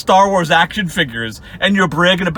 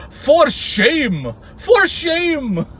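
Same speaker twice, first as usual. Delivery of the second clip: high, hammed-up reproach; mock scolding; 9 semitones higher, much slower than usual. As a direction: imitate a pompous finger-wagging rebuke. Mocking nemesis's scolding email opener.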